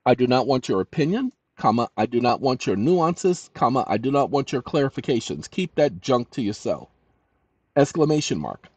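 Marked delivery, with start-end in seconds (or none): none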